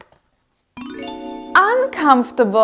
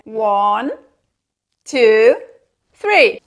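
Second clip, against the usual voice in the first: building countdown; slower than usual. Acted. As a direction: build suspense to the reveal.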